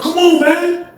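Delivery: imitated impatient yell; impatient calling. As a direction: hurry someone from afar. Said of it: "He's like".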